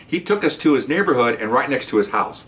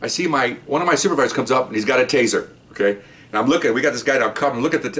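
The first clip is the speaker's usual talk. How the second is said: normal talking voice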